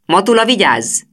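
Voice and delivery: high, loud barked order; command shout. A narrator's reading. Teacher ordering the pupils to stand, a loud command. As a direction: snap the class to attention. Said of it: "kiáltotta Hajdú".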